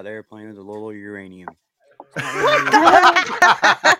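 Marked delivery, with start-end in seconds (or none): 2.2-4.0 breaks into high loud laughter; can't finish for laughing; words lost in a burst of laughter. Real, not acted. Laughing mid-sentence at own joke.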